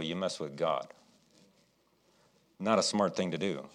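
usual speaking voice

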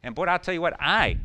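ordinary talk

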